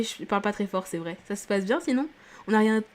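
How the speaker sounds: ordinary talk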